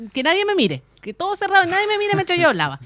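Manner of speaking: nervous, pleading retold demand; flustered plea. Retells her shy condition, flustered.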